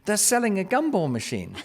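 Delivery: high, eager imitation of a kid; excited child. Daughter running to dad about a find, excited. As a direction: call out like a child with a great find. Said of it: "my daughter comes running to me".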